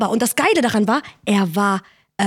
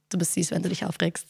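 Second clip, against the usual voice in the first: mock soothing lover, softer; 5 semitones lower, 9 dB quieter than usual. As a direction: coo it down to her like a patronizing boyfriend. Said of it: "am besten macht er so". (Freund beruhigt sie, leise und herablassend gespielt)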